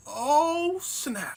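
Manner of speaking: high loud "Oh," then a falling "snap"; stunned "oh snap". Retold or imitated. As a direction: gasp it, caught off guard. John watching Marilyn sing.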